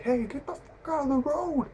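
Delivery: high-pitched strained mock yell, held back; mock scream. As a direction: imitate an angry driver screaming, without full volume. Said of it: "screams, he's like".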